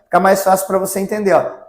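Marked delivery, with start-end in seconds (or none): none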